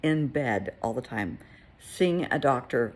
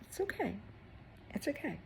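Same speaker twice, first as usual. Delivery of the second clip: high, soft and gentle; calm a crying child softly; soothing reassurance; 9 semitones higher, 10 dB quieter, faster than usual. Retold or imitated. Soothing a boy holding back tears.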